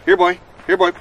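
high sing-song dog call. Acted. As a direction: call him like a puppy. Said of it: "He's not a dog".

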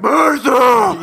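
high, loud hammed-up cry; mock anguished shout. Co-host parodying the Superman and Batman mother moment, hammed-up shout. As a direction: bellow the name in mock anguish.

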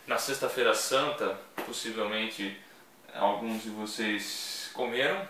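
ordinary talk, nothing marked

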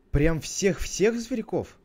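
high, loud, drawn-out disbelief; childlike astonishment, slow and loud; amazed question. Acted. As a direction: stretch it out in wide-eyed wonder. Abel amazed at the offer.

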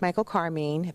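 usual speaking voice

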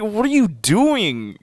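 high, drawn-out mock outrage; exaggerated high-pitched protest; retold disbelief. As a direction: replay your incredulous reaction, slowly and high. Retells own shocked reaction.

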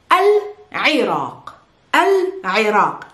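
Al-Iraq slow and split, "al... 'i-RAAQ"; throaty Arabic ayn. Shown as a demonstration. Teacher saying Al-Iraq for learners, slow with throaty ayn. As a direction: squeeze the throat after Al in Al-Iraq, end on q. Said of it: "pronounce this ayn from the throat".

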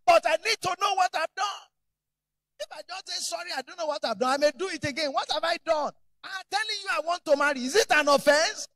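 high, loud protesting son; pleading shout. Retold or imitated. Son shouting back at his stern father, high and loud. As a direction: argue back hard with dad.

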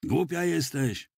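high, slow call back; shouting back. A narrator's reading. Young soldier answering after a moment's hesitation, shouting back. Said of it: "odkrzyknął po chwili wahania młody żołnierz".

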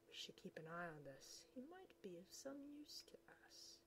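soft hushed plotting; soft conspiratorial whisper; scheming whisper. Acted. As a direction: plot in secret from hiding. Villains scheming in hiding.

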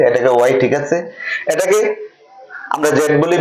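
normal talking voice

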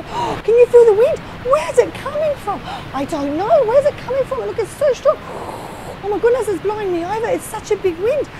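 amazed disciples in strong wind; wind in the face. Pretend. Disciples feel wind blowing.

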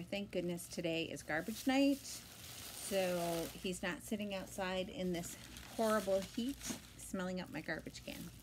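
ordinary talk, nothing marked

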